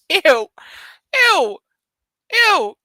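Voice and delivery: high, loud grossed-out ew; disgusted. Real, not acted. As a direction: recoil from it in disgust. Reacts to the pickup line.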